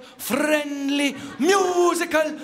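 musical words shouted high and loud; mock Welsh shout. Shown as a demonstration. Comic shows a Welsh accent turning loud. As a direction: belt them out like a friendly Welshman. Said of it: "Any other accent".

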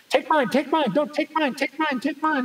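high-pitched overlapping pleas; insistent high mock clamor; imitated clamoring relatives. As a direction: mimic a crowd fighting to be served first. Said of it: "they're all fighting".

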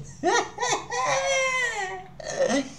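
high, cracking burst of laughter; wheezing high laugh; big laugh. Real, not acted. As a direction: crack up helplessly. Laughing at the British accent.

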